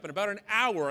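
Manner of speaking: ordinary talk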